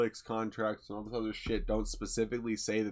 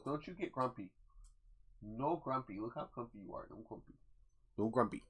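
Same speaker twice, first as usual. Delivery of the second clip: pet talk; 12 dB quieter than usual. Real, not acted. Talking softly to his pet Punko.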